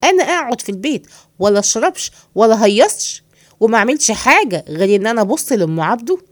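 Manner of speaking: ordinary talk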